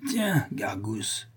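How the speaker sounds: put-on slow, hushed drunken call; drunken master. A narrator's reading. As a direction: drag it out softly to the monkey. Drunk master instructs his monkey.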